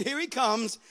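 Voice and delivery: ordinary talk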